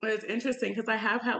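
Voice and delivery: ordinary talk, nothing marked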